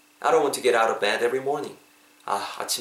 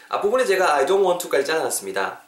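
normal talking voice

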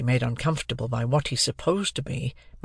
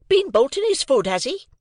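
high, loud outburst from the sister; crying out; 13 semitones higher, 4 dB louder than usual. A narrator's reading. Sister catching Pip out over his food, crying out. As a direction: exclaim shrewishly, pitch leaping up. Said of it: "cried my sister".

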